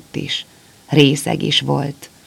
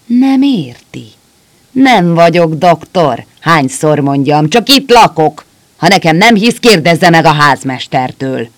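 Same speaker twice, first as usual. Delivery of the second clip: angrier; 4 semitones higher, 8 dB louder than usual. A narrator's reading. Drunk doctor yells at the kneeling soldier, furious and loud. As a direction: hammer each nem at him. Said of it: "Rézkígyó mind dühösebb lett".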